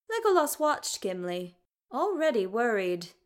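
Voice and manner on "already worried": ordinary delivery, nothing marked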